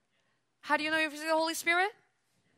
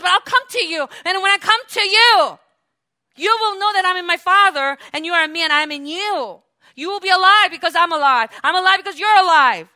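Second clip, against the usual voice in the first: louder, ringing pulpit delivery; a preacher booming the promise of Jesus; preaching at full force; 6 semitones higher, 13 dB louder than usual. Acted. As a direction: proclaim it to the congregation.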